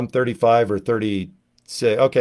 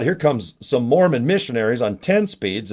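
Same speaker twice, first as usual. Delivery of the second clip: normal talking voice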